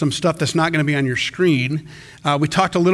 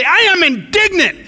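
high, louder mock declaration of anger; indignant outburst; 13 semitones higher, 5 dB louder than usual. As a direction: blurt it out, pitched up and louder. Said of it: "if you say".

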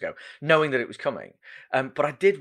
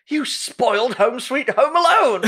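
high, slow, laughing mock complaint; hammed-up indignant whine; mock outrage; 12 semitones higher, 7 dB louder than usual. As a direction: play a listener huffily accusing a spoiler, half laughing. Imagined listener complaining about spoilers.

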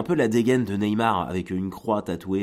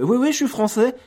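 much higher, louder nervous squeak; squeaky panicked answer; 7 dB louder than usual. Retold or imitated. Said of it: "le mec fait". (réponse aiguë et paniquée)

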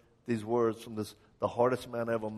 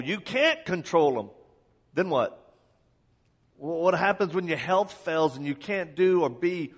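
higher, louder pleading sermon; fiery preaching; 4 semitones higher, 5 dB louder than usual. Acted. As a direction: press the listener hard with questions. Preaching at full force.

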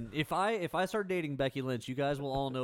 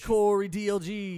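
ring announcer; 5 semitones higher, 7 dB louder, slower than usual. Pretend. Announcing himself as champion.

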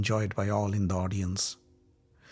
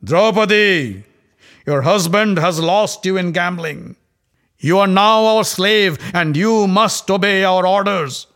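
much louder, higher booming taunt; roared; 6 semitones higher, 7 dB louder than usual. Acted. Dussasana roars at Draupadi from her door, booming. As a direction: roar from the doorway like a brute.

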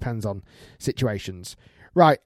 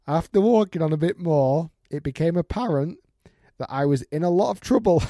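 higher, louder storytelling, dragging out towards the end; amused story read-out; 4 semitones higher, 3 dB louder than usual. Acted. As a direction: read the tale with mounting amusement. Reads out a listener's email, amused.

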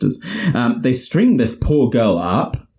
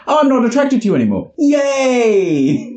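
mock cheerful; 12 semitones higher than usual. Retold gleeful reaction.

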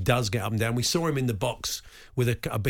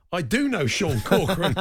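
laughing; 6 semitones higher, 4 dB louder than usual. Real, not acted. Reading out the punchline, laughing.

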